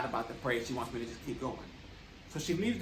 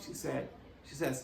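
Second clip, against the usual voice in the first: usual speaking voice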